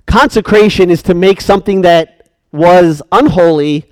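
usual speaking voice

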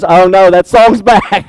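hammed-up reluctance; mock groan. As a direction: pretend to dread the song. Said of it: "not because".

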